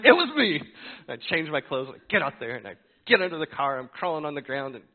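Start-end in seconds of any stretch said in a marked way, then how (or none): 0.0-0.6 much higher, much louder snapped admission; angry shout. Retold or imitated. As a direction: yell it out mad. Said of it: "I was like".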